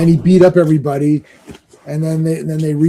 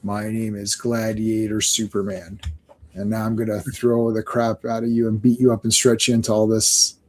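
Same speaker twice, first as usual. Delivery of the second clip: low, subdued alien reply; Gladiator; 5 semitones lower, 6 dB quieter than usual. Acted. Gladiator answering the Fantastic Four, low unimpressed voice. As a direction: drop the pitch for a deadpan threat.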